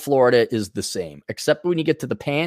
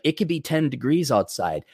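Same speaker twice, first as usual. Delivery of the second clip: usual speaking voice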